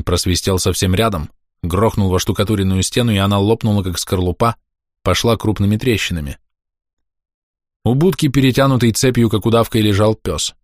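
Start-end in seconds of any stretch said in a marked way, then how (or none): none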